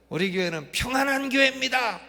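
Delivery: high, loud rallying cry; imitated slogan shout. Preacher imitating a pastor's second slogan, high loud shout. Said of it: "하고 소리를 지르니까".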